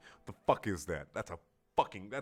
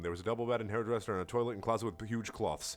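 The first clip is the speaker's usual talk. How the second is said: ordinary delivery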